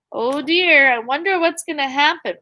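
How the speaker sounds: usual speaking voice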